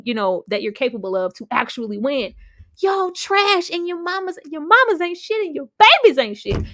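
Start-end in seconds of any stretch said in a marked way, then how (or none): 2.8-6.6 higher, building louder insults; Trixie-style rant. Retold or imitated. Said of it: "gave 'em that Trixie Players Club speech".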